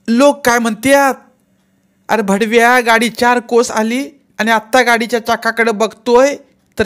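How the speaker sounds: high, loud angry tirade. A narrator's reading. Aunt scolding the driver over the wheels, loud angry scolding. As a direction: tear into him at full volume. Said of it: "Gangwa रागावली".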